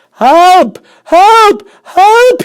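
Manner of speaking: extremely high, loud cries, rising each time; a boy drowning in chocolate yelling for help. Acted. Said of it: "he yelled".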